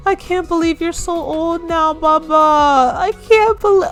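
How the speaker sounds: high, choked-up voice near tears; emotional. Real, not acted. To her aged Sim Sage, choked up and emotional. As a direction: let the voice climb high and break with feeling. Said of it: "I'm getting so emotional".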